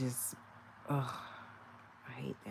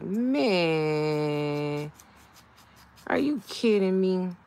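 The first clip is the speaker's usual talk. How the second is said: loud, thrilled "are you kidding me"; excited disbelief; 4 semitones higher, 9 dB louder, much slower than usual. Retold or imitated. Telling viewers how she reacted to the new stove, loud and thrilled. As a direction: burst out in happy disbelief.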